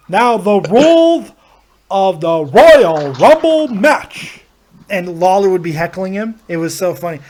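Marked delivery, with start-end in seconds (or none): 0.1-4.4 slow, loud ring-announcer proclamation; Howard Finkel. Acted. Announcer gets his cue at the Royal Rumble, grand proclamation. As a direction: swell grandly on the event name. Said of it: "he would look at the camera".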